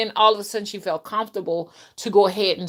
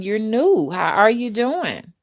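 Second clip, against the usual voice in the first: normal talking voice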